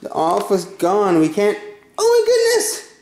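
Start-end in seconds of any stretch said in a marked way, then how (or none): 2.0-2.9 high-pitched, delighted discovery; excited exclamation. Real, not acted. Parent announces to viewers that Natalia found the elf, high and excited.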